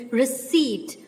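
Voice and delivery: "receipt" said as "re-seet", no P; silent P. Shown as a demonstration. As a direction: give "receipt" its correct sound, ending "-seet". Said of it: "P is silent". ("receipt" में P silent, "रिसीट")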